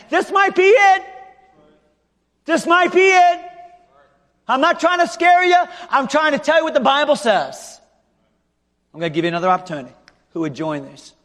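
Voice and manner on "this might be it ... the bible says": shouted high preaching warning; fiery warning. Acted. Pastor warning the unsaved listener, shouted high. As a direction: warn them at full force.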